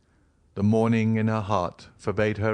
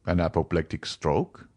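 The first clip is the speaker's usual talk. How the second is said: lower, rising question from the doctor; the doctor echoes the news in a lower, questioning voice; doctor repeating; 3 semitones lower than usual. A narrator's reading. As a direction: echo it back in a lower, doubtful voice. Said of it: "repeated the doctor".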